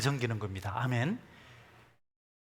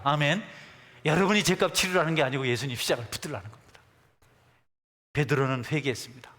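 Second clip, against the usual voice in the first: normal talking voice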